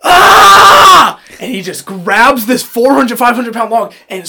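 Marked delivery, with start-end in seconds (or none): 0.0-1.2 retold grunting yell. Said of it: "he hears Matt just go".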